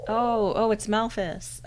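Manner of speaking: usual speaking voice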